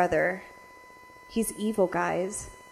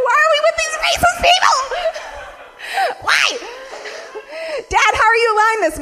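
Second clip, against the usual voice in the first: high, fast, loud protest; exasperated shouting plea; outraged yell; 5 dB louder, faster than usual. Retold or imitated. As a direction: shriek at dad in disbelief. Protesting racism at dinner.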